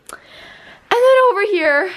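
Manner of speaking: gasping the words out after rushing; out of breath. Real, not acted. Showing her room to viewers, breathless and high. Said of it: "oh my gosh, I'm so out of breath".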